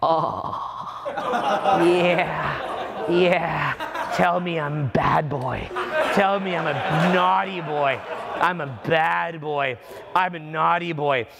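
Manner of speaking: slow, drawn-out relishing of the insults; mock pleasured moaning.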